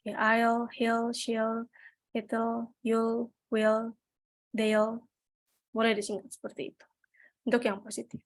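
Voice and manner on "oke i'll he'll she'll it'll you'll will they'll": I'll, he'll, she'll, it'll, you'll, they'll each said short and clipped; contraction drill. Shown as a demonstration. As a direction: read the list slowly like a teacher. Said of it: "Kalau they berarti they'll".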